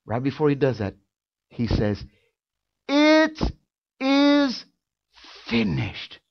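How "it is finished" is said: Jesus' last words, slow and weighty; drawn-out pronouncement. Acted. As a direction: space out It is finished word by word, falling on finished. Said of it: "It wasn't a shout".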